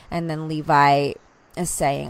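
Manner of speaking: ordinary delivery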